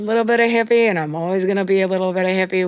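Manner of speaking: high, loud and fast, proudly; proud defiant declaration. Real, not acted.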